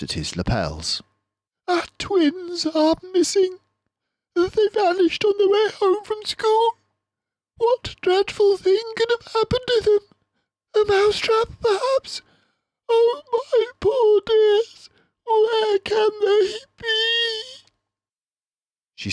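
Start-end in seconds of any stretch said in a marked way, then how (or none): 1.7-12.2 very high, loud, panicked; a mother mouse terrified for her twins; frantic mother. A narrator's reading. 12.9-17.4 slow, high crying plea; mother mouse sobbing for her twins. A narrator's reading.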